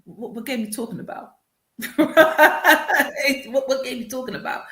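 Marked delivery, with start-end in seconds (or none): 1.8-3.4 a burst of real laughter. Real, not acted. Laughs at the memory. 3.5-4.6 laughing through the repeated question. Retold or imitated.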